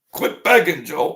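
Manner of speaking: high, loud mock reprimand; acted scolding. Critic interrupts Joe's sales pitch, loud mock scolding. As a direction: tell Joe off in a put-on outburst.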